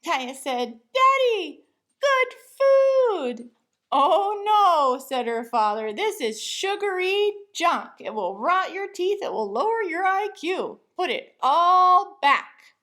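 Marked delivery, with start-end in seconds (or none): 0.9-3.5 put-on high, loud little girl voice; excited child. A narrator's reading. Little girl showing her father the ice cream, high and loud. 3.9-12.8 higher, loud parental scold; scolding father. A narrator's reading. Father telling his daughter to put it back, raised scold.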